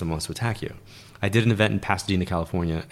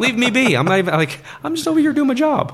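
high, loud little-creature voice, much higher than her own; squeaky puppy voice; 6 dB louder than usual. Retold or imitated. She voices the new puppy to her host in a high squeak. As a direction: play the pup who just wants to be left alone.

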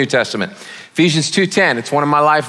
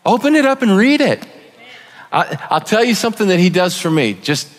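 ordinary talk

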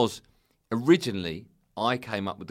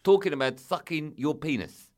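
loud, raised-pitch South African agent; hammed-up South African outrage, close to laughing; South African accent; 5 semitones higher, 10 dB louder than usual. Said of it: "I'll do the accent".

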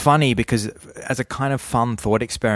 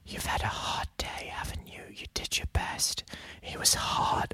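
whisper; 12 dB quieter than usual. Retold or imitated. Retelling an ASMR video to a friend, whispered. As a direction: hush it like a soothing video voice.

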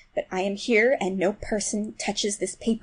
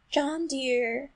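high, soft and coaxing; gentlest voice; 5 semitones higher, 3 dB quieter than usual. A narrator's reading. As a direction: call out sweetly and softly, pitched up. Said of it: "in the gentlest voice".

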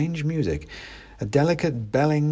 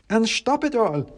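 clacking wooden soldier; 12 semitones higher than usual. Acted. Klaus warns the narrator not to eat, clacking. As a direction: warn in a stiff wooden clack. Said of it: "said Klaus".

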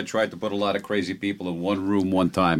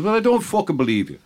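high, incredulous stage-Irish; pitched-up bad Irish accent; horrible Irish accent; 8 semitones higher than usual. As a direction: scoff in a hammy Irish brogue. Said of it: "And the Irish fella says".

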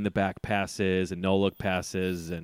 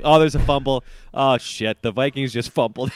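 excited play call; 4 semitones higher, 6 dB louder than usual. Retold or imitated. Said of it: "kept saying".

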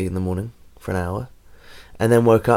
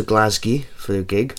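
mock Scottish accent; 5 dB quieter than usual. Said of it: "Is that meant to be a Scottish accent?".

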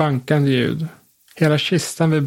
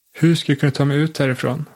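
usual speaking voice

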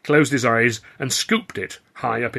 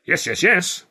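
higher, louder brushing-aside; insistent; 8 semitones higher, 5 dB louder, slower than usual. A narrator's reading. Old clown overrules the modest young man, insisting. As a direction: press the point eagerly. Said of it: "Walker insisted".

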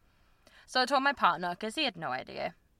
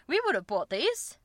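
high, indignant mock complaint; imitating partner's exasperation; annoyed partner; 8 semitones higher than usual. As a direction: whine about missing sizes like the boyfriend. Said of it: "and be like".

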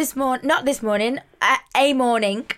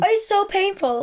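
mock pained whine; 8 semitones higher than usual. Real, not acted. Showing her bruise.

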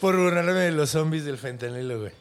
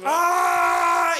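low mock battle cry; deep hammed-up power shout of a monk; power-up yell; 5 dB louder than usual. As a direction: roar like Goku charging power. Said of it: "Así grita".

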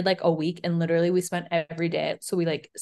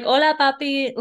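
mock Spanish flirt; 7 semitones higher, 6 dB louder than usual. Two friends joke about how Irish people saw her, teasing mock Spanish flirt. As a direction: toss it off like a teasing fake Spanish greeting. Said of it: "I wasn't out there being like".

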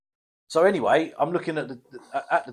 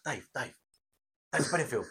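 softer, trying not to be overheard; discreet hush; 10 dB quieter than usual. Retold or imitated. Friend in small shop calling mate, softly hushed. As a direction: keep it down, urgent. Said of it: "I'm being all discreet".

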